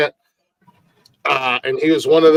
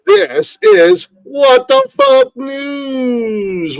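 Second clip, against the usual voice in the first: drawn-out, much higher, louder announcer call of "This is What the Fuck News"; show announcer; 12 semitones higher, 4 dB louder, much slower than usual. Acted. Podcast host kicks off his news segment, booming announcer style. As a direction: stretch it like a big TV intro, very slow.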